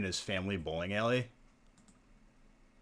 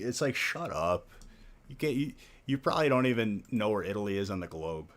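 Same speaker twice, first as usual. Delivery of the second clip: normal talking voice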